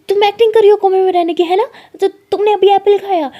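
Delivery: high, loud, fast accusing shout; excited accusation. Retold or imitated. Lakshmi catching Lily faking her coma, loud accusing shout.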